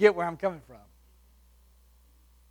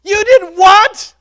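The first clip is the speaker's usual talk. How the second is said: high, slow furious shout; outraged; 13 semitones higher, 17 dB louder, much slower than usual. Retold or imitated. Car owner yelling at the kid who hit his car, outraged. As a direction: yell it in disbelief at the kid.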